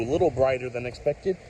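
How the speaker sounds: ordinary delivery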